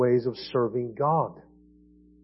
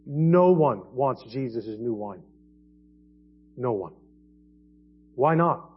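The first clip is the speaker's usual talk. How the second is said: ordinary talk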